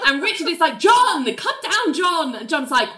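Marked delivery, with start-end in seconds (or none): 0.8-2.3 high, drawn-out mock call; imitated high-pitched summons. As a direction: call up to someone in put-on exasperation. Acting Richard calling John down.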